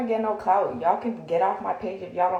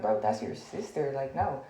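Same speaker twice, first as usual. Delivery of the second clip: low hushed refusal; 5 semitones lower, 6 dB quieter than usual. Retold or imitated. Retelling refusing to fight.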